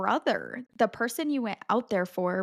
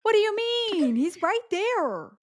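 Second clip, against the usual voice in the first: high, disbelieving protest; incredulous outrage; 10 semitones higher than usual. Real, not acted. Friend protests the brother's choice, incredulous and high. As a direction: protest that he is right there.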